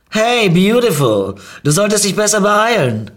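loud, high, stretched call. Acted. Stranger calls to the narrator from her car, calling out. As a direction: shout it teasingly from a car.

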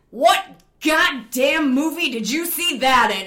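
put-on loud, high, scornful bark from an old codger; furious old alpine instructor yelling; berating yell. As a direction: tear into the scared student in front of the class. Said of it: "he just berates me".

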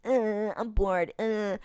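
slow, drawn-out bratty whine; whiny bored brat. Retold or imitated. Dean whining at Sam to pester him, slow bratty whine. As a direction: whine it like a bored kid annoying his brother. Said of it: "he's kind of being, like, a little asshole".

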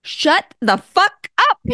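high, slow, stretched-out amazement; amazed disbelief. Retold or imitated. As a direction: drag it out in happy disbelief. Reaction to meeting energy healer.

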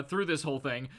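normal talking voice

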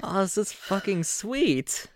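high, delighted exclamation; thrilled at the surprise. Acted. Fern delighted by curtains.

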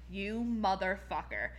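low, slow, quiet curse; retold seething anger. Fan recalling reply to man, low seething curse. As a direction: say it under control, slowly and low.

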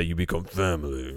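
low, soft imitating Vin Diesel; a light touch of Vin Diesel gravitas; Vin Diesel impression. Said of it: "as Vin Diesel would say".

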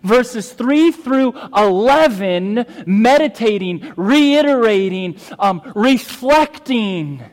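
loud, high, slow preaching emphasis; forceful preaching. Acted. Pastor expounding the psalm to the congregation, forceful preaching. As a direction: preach it out, drawing out each key word.

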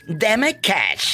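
mocking. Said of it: "Aquí dicen".

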